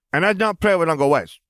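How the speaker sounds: put-on high toddler showing off toys; small child's voice. A narrator's reading. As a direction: announce it in a little boy's high voice. Toddler shows off toy starfighters.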